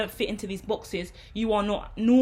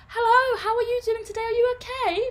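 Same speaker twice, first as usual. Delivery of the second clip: very high, bright sing-song nursery teacher; high sing-song voice for a classroom of small children; 14 semitones higher, 6 dB louder than usual. Shown as a demonstration. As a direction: gush like a teacher praising a toddler. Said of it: "If I'm working with children".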